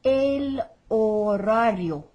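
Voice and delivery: slow "horario", H unsounded, R's rolled; silent H, rolled R's. Shown as a demonstration. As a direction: model "horario" slowly as o-RA-rio with Spanish R's. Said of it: "with a silent H".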